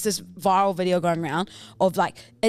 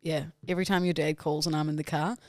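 normal talking voice

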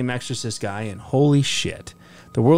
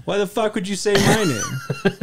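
higher laughing indignant protest; mock outrage; 8 semitones higher than usual. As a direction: repeat it higher, half laughing.

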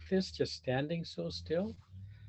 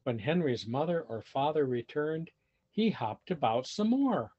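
put-on lively read-aloud for children; animated storybook reading; story narration; 4 semitones higher than usual. A narrator's reading. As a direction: tell it like a picture book to kids. Reading picture book aloud.

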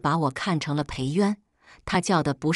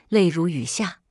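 ordinary delivery, nothing marked

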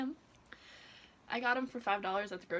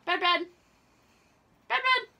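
loud, high sing-song call; calling the cat; 8 semitones higher, 10 dB louder than usual. Real, not acted. Owner calling her cat Bread, loud high call. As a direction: call her across the house.

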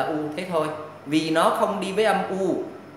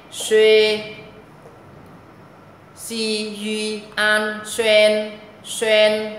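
very slow, drawn-out chant, loud on "suy" and "xuyên"; đánh vần thật chậm; slow spelling drill; 3 semitones higher, 3 dB louder, much slower than usual. Shown as a demonstration. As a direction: drag the spelling out for pupils to copy. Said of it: "chữ X".

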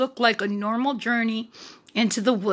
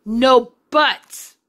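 scolding, pushed louder; 7 dB louder than usual. A narrator's reading. Mother repeats the scold to her children, louder. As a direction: say it again with more force. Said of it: "their mother scolded".

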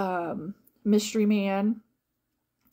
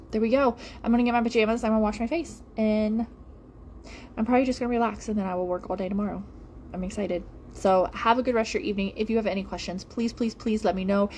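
usual speaking voice

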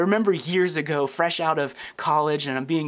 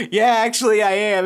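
loud reveal; 5 semitones higher, 6 dB louder than usual. Retold or imitated. Pastor revealing himself, punchline.

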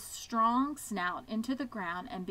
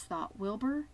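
plain reading voice

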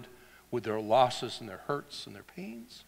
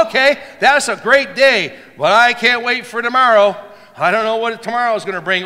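mock-anxious voice, loud and fast; worried churchgoer; 10 semitones higher, 18 dB louder than usual. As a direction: act out someone fretting about tomorrow. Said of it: "Sometimes we go".

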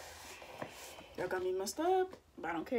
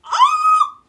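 sudden very high yelp; shocked shout; 19 dB louder than usual. Real, not acted. Watching the video, a sudden shocked yelp. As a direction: burst out in shock.

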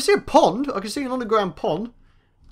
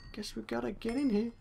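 much softer, slightly high repeat; quiet aside; 4 semitones higher, 13 dB quieter than usual. Real, not acted. Player reluctantly going in, quiet aside to self. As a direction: say it again under your breath.

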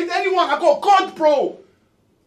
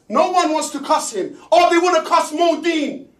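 usual speaking voice

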